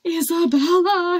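plain reading voice

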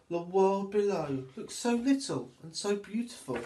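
put-on lively, sing-song reading for little children; storybook narration. A narrator's reading. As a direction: read it like storytime for toddlers. Story narration, rising excitement.

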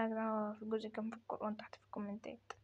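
hushed, breathy aside to the viewers; whisper. Real, not acted. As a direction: whisper it like a secret. Repeats a viewer question, hushed. (همس)

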